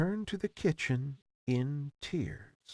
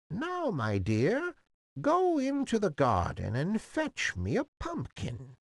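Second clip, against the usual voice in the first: put-on old fairy godmother; 8 semitones higher than usual. A narrator's reading. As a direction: send her off in a high, swooping crone voice. Fairy godmother gives Cinderella orders.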